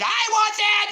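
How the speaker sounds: very high, loud mock tantrum shriek; screaming brat. Imitating kids fighting over the hot case, screaming. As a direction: screech it like a demanding little kid.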